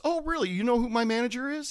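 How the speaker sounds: high, sarcastic oh really; sarcastic fake surprise; mock surprise. Bartender mocking a name-dropping customer, high sarcasm.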